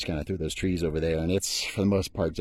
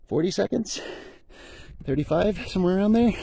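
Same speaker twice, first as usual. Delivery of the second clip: still winded; 11 semitones higher than usual. Real, not acted. Checking time after the sprint.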